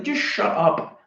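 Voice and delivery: high-pitched exasperated muttered thought. Retold or imitated. Comedian still thinking at the laughing owner, exasperated. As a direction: pitch it up, fed up with him. Repeats his fed-up retort.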